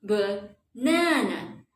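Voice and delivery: BANANA slowly, short ba then long strong NA; stretched middle stress. Shown as a demonstration. Teacher demonstrating stress, slow Banana with long middle NA. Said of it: "second syllable will be longer".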